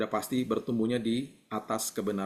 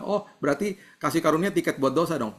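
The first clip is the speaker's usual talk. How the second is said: high, louder voice of a sceptic; mimicked objection; 6 semitones higher, 5 dB louder than usual. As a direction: imitate someone challenging you with a glib conclusion. (menirukan orang menyanggah dengan nada tinggi)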